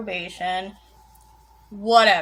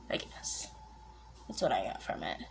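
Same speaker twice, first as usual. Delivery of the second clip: whispered; 13 semitones lower, 12 dB quieter than usual. Real, not acted. Whispered afterthought.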